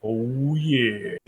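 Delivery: low, soft, drawn-out croon of approval; smooth jazz DJ. Pretend. Co-host agrees on the mock jazz station, low smooth murmur.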